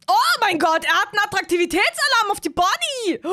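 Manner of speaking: high, loud excited exclamation. Real, not acted. Streamer reacting to the attraction alert, high and excited. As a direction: burst out at the alert.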